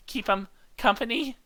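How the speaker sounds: ordinary talk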